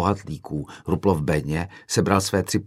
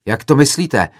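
loud, higher indignant question; agitated yell; 6 semitones higher, 7 dB louder than usual. A narrator's reading. Another student reacts to an accusation, yelling agitatedly. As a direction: throw it back, upset and raised. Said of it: "zařval rozčileně jiný student".

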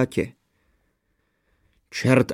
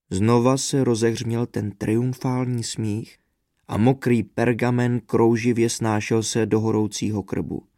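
usual speaking voice

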